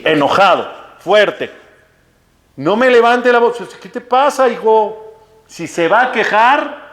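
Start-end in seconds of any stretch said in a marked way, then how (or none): 2.6-3.6 high-pitched telling-off; acted-out offended reprimand; retold indignant rebuke. Clerk rebukes the irate customer. 3.8-6.9 high-pitched, affronted challenge; acted-out telling-off; retold indignant scolding. Clerk scolds the irate customer.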